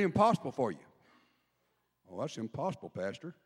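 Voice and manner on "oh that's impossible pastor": doubting churchgoer. Pretend. Doubting churchgoer mocked by pastor.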